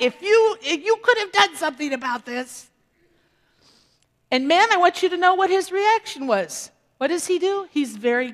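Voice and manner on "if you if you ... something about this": high, louder mock accusation; hammed-up blaming spouse; nagging wife. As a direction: tease the wives with a reproachful whine. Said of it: "Ladies, are we good at things like that?".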